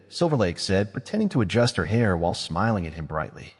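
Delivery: ordinary delivery, nothing marked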